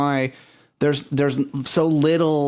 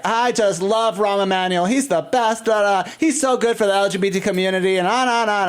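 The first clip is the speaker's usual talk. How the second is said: mock drunk fan; 7 semitones higher than usual. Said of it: "And he's like".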